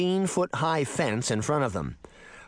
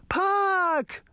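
high, drawn-out frightened call; terrified shout. A narrator's reading. Girls fearing Puck has been killed, shouting in terror. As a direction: cry the name out in high alarm. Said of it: "the girls shouted, terrified".

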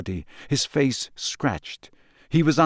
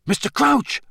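high, raised-voice call; loud call; 12 semitones higher than usual. A narrator's reading. Harry to the collapsed man, loud and urgent. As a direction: call his name sharply to get through to him. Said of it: "Harry said loudly".

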